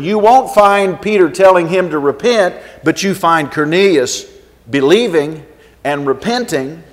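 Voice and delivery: ordinary delivery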